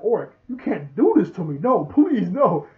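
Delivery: high, loud mock protest; pleading teammate. Teammate begging Orc not to get hurt, hammed-up plea. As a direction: plead loudly in an exaggerated high voice.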